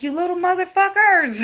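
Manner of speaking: high, loud mock yell; yelling at kids. Mom yelling at her kids, mock yell. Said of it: "I be like".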